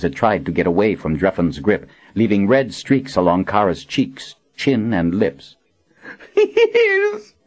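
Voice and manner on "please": very high, terrified wail. A narrator's reading. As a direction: wail in agony and terror. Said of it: "Kara wailed".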